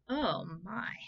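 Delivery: usual speaking voice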